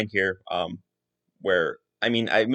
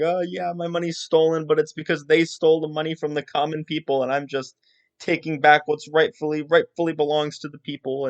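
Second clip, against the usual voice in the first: higher pitched, lightly whimpering mock sob story; mock tearful plea; 6 semitones higher than usual. Daisy pleading with Seliph to join, mock tearful. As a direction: fake the tears to win him over. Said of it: "getting really sad and, and sort of crying".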